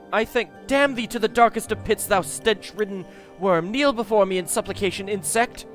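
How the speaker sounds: high, loud demonic villain voice; dark lord. Acted. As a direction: sneer it like an evil overlord. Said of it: "fiend's tongue".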